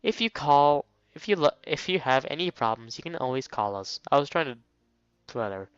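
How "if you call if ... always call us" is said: ordinary talk, nothing marked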